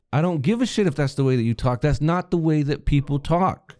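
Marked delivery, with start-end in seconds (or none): none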